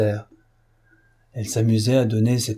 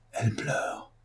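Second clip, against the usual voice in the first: put-on near-whispered, very soft child's confidence; hushed half-voice; 2 semitones higher, 10 dB quieter, slower than usual. A narrator's reading. Child confiding in her father about someone crying, hushed half-voice. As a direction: drop almost to a whisper. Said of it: "elle lui dit à demi-voix".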